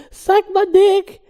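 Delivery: high, loud, slow jeer; mock outburst. Real, not acted. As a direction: fling it out as a joke. Joking outburst in reading.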